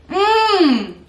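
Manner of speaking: a pleased hum at the taste; delighted mmm. Real, not acted. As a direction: savour the first spoonful out loud. Tasting the yogurt.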